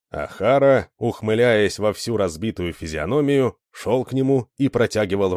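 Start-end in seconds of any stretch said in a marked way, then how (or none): none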